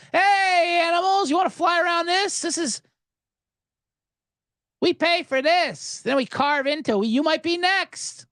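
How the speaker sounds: mock taunting. Mock speech to the flies.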